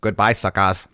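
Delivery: low robotic taunt; eighties robot voice. Retold or imitated. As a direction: gloat like a cheap robot. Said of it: "Old school's nineteen eighties robot voice".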